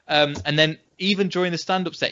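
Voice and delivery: ordinary talk, nothing marked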